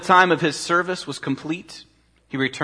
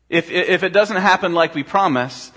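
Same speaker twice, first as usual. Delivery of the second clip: usual speaking voice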